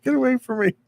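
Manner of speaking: high mock voice of a grumpy son; imitating son. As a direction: squeak it out like a young man brushing fans off. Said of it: "He was telling them to get away".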